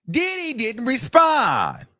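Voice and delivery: slow, high drawn-out punchline; dramatic pause. Real, not acted. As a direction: stretch it out for effect. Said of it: "dramatic punchline delivery".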